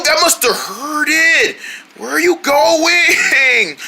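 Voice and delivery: normal talking voice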